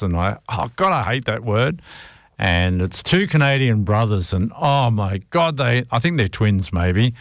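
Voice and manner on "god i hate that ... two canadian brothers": ordinary talk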